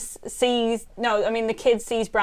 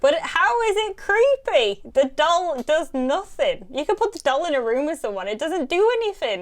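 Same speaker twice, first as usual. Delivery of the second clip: incredulous; 7 semitones higher than usual. Real, not acted. Ranting about the harmless doll.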